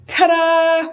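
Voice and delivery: spring the surprise with a flourish; triumphant reveal. Real, not acted. Finding towels in the mirror.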